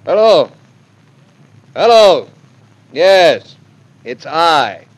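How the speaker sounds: loud, high, impatient hello; brusque phone greeting. Acted. Husband ringing his mother-in-law, loud and brusque. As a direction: call firmly down the line.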